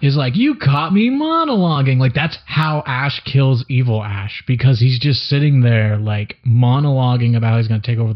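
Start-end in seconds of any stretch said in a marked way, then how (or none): 0.4-2.0 higher, smug cartoon-villain imitation; villain Syndrome. Syndrome caught by the hero, smug higher villain imitation. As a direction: toss it off like a caught-out supervillain. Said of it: "Syndrome, the bad guy, is like".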